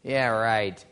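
low, soft, scoffing disbelief; dismissive townsfolk. Retold or imitated. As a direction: brush him off, not buying it. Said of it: "And everybody's like".

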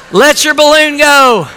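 high, loud, ringing exhortation; rousing preaching. Real, not acted. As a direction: press it home to the congregation. Preaching at full force.

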